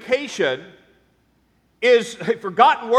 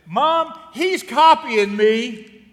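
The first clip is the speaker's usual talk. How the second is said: put-on slow whining child; whiny kid voice; 3 semitones higher, 4 dB louder, much slower than usual. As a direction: whine it like a tattling kid. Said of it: "you'd hear something like this".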